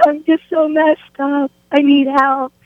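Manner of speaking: lightly acted crying, voice lifted; mock tearful. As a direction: imitate a tearful plea for help. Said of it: "I'm crying".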